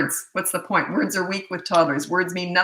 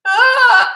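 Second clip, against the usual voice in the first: loud, very high mock child's wail; imitated crying wail; 12 dB louder than usual. Mother imitating her son hearing his game is gone, loud wail. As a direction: burst out like a kid starting to cry. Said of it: "he went to cry and he went".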